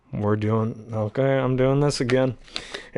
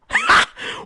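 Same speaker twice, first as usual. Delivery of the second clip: shrieking laugh. Real, not acted. Huge shrill laugh.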